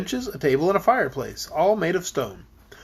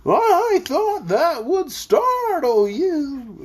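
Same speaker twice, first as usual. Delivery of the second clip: high, very slow and wobbling; pleased bumpy voice; 11 semitones higher, 3 dB louder, much slower than usual. A narrator's reading. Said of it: "well pleased".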